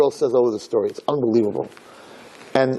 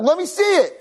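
high-pitched mock begging; eager pleading. Bride begs friends to show the present, eager. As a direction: act out an impatient girlish plea.

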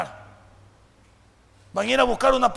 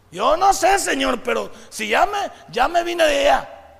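loud, slow, stirring preacher delivery; preaching at full force; 5 semitones higher, 9 dB louder, slower than usual. Acted. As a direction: preach it big, building to a shout. (predicando con toda la fuerza, en voz alta)

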